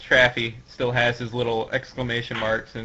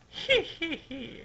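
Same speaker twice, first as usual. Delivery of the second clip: high, drawn-out snicker; put-on high "hee hee hee"; little villain laugh; 5 dB quieter, slower than usual. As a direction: snicker slowly like a smug villain. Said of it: "He's doing his little".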